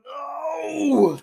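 loud high panicked no; panicked yelling. Real, not acted. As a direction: yell as it sprays. Drink sprays on friend.

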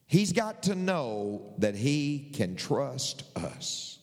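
each word drawn out slowly and deliberately; slow, emphatic restatement. Real, not acted. Preacher repeating the point to the congregation, slow and deliberate. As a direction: say it at half speed, spacing the words for weight.

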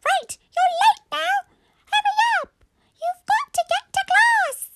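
loud, very high squeaky scolding; cross mum in a piercing squeak; unhappy squeaky mummy. Acted. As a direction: shriek orders like a squeaky toy. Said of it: "Mummy was not happy".

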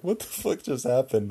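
high-pitched mock alarm; lightly acted freak-out; mom freaking out. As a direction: imitate mom's rattled disbelief. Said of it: "freaking out".

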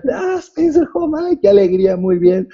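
high gushing visitor voice; imitated delighted guest. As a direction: play the gracious famous guest. John's voice, retold.

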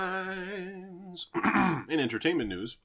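bright announcer voice reading headlines; news anchor read. Acted.

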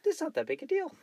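very high squeaky mock voice; put-on high voice. Teasing a partner about getting naked, silly high voice. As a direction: tease with a silly falsetto quote. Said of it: "in that voice, too".

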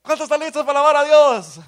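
big cheerful stage call; rousing call. Retold or imitated. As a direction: shout it like a worship leader firing up the church. Worship leader calling the crowd.

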